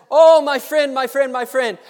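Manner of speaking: a loud, high, eager greeting; eager pastor. Acted. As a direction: call out to him like a delighted old friend.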